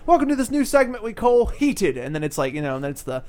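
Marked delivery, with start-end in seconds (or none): 0.1-2.0 higher, louder British presenter voice; James Corden. Acted. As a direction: announce it like a British talk-show host. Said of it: "in his British accent".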